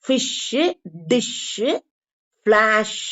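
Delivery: ஃபிஷ், டிஷ், ஃபிளாஷ் each ending on held sh; final SH. Shown as a demonstration. Teacher drilling sh at word end for learners, held. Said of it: "வர்றது இஷ் sound".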